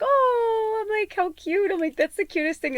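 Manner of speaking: very high, gushing coo; cooing over cuteness. Retold or imitated. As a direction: gush like it is adorable. Mistakes word for baby talk.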